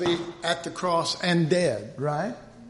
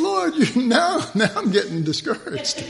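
laughing mock whine; 6 semitones higher, 5 dB louder than usual.

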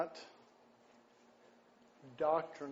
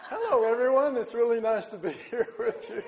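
louder, breaking into laughs mid-greeting; laughing greeting; 7 semitones higher, 6 dB louder, faster than usual. Real, not acted. Preacher restarting the sermon, still laughing. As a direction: greet them while still cracking up.

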